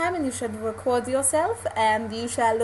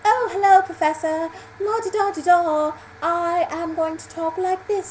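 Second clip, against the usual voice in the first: higher, la-di-da British accent; mock posh British accent; 5 semitones higher than usual. Shown as a demonstration. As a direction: put on an affected posh English accent. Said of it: "doesn't work with that kind of accent".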